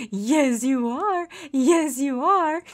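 sing-song doting praise; cooing pet talk. Real, not acted. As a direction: fuss over an adored puppy.

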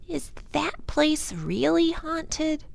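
put-on high, slow, wide-eyed girl's question; a small girl asking her brother about a spooky house; little girl voice. A narrator's reading. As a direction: ask it like a curious, half-scared child.

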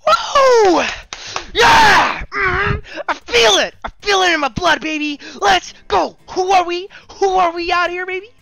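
high, loud hype screaming; triumphant yelling. Real, not acted. Streamer celebrating the rare find, roaring triumphantly. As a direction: scream in victory, pumped up.

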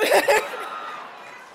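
high giggling laugh. Real, not acted. Speaker tickled by applause, high laugh. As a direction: burst out laughing. Laughing after own exclamation.

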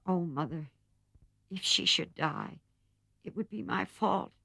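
high, breaking voice near tears; a guilty daughter close to tears; tearful plea. A narrator's reading. Jo to her mother beside the sickbed, tearful and guilty. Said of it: "in a passion of penitent tears".

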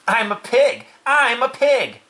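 high, loud boastful chant; boy's yelling. A narrator's reading. Boy crawling in the crate, high silly yell. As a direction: shout it high, showing off. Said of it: "yelled Avery".

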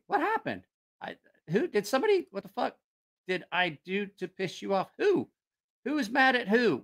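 squeaky, high-pitched puzzled roommate; confused Shotwell. Retold or imitated. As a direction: play a baffled guy who can't see what's wrong.

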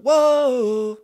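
long high loud "whoa" holler; crowd-finding shout. Shown as a demonstration. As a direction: holler out to find your people across a field. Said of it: "I'd shout".

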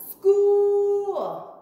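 high, loud school; excited announcement. Real, not acted. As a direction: shout the word out with joy. Announcing hundred and three days.

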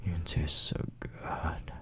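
put-on breathy close-mic whisper to a girlfriend; teasing boyfriend whisper, close and intimate. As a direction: whisper it right into her ear.

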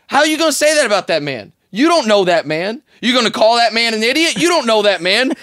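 high, loud mock-indignant challenge; indignant rebuke. Athlete passing by scolds radio host, indignant. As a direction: confront him in disbelief, pitched right up.

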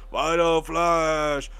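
drawn-out but half-hearted attack cry; under-energised shout. Retold or imitated. Mimicking a weaker dub's Final Flash, half-hearted call. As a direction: hold back on the power, stretch it out. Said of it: "or just, like, a".

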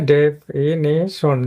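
Definition very slowly, as de... fi... ni... shon; drawn-out teacher's breakdown of definition into four parts; syllable by syllable. Shown as a demonstration. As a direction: pause between each syllable of definition. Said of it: "এখানে একটা আলাদা করবে syllable".

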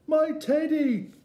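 put-on very high, loud child's cry; squealed high exclamation. A narrator's reading. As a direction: cry out as an excited small boy. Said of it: "cried Eddie".

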